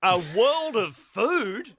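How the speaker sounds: high, very slow disbelieving echo; incredulous echo. Real, not acted. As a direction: savour the absurd phrase. Echoing quote in disbelief.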